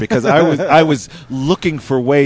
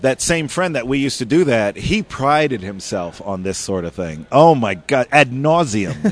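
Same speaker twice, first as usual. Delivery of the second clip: ordinary talk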